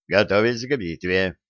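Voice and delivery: plain reading voice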